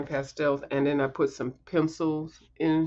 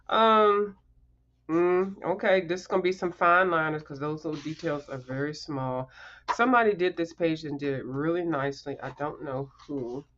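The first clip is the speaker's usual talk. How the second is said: normal talking voice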